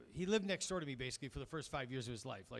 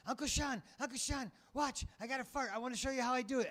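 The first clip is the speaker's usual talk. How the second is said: put-on high excited child voice, much higher; little kid voice; 10 semitones higher, 3 dB louder than usual. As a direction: play the eager little nephew, high and bright. Kid nephew voiced in story.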